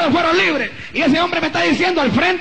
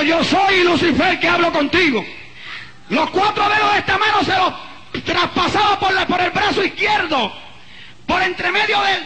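building, raised preacher's cry; higher-pitched testimony, swelling toward a shout; impassioned preaching; 5 semitones higher, slower than usual. Real, not acted. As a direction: preach the ordeal with mounting force, each phrase pushed out.

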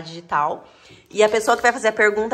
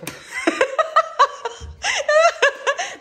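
high burst of laughter; laughing. Real, not acted. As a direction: laugh at your own stumble. Laughing at own mispronunciation.

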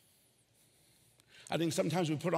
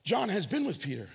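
ordinary delivery

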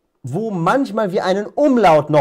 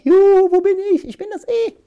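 high, fast, cheerful little voice calling out; squeaky letter voice; 3 dB louder, faster than usual. Pretend. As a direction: play the lost E waving from far away. The E voiced as character. (hohe, fröhliche Piepsstimme)

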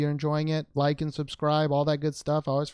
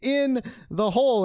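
high, loud crowing over a win; real elated triumph; 13 semitones higher, 5 dB louder than usual. Real, not acted. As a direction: crow in triumph, pitched way up. Celebrating a rare card pull.

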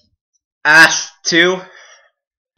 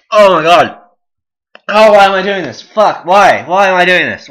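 loud, raised wail of regret; pained regret; 5 semitones higher, 6 dB louder than usual. Real, not acted. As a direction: cry out in burning misery. Regrets it while mouth burns.

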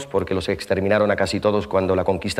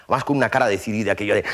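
usual speaking voice

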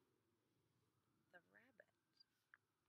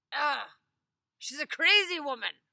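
loud, higher scoff; scoffing Ivan; 6 semitones higher, 16 dB louder than usual. Acted. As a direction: laugh her off as crazy. Ivan dismisses wife.

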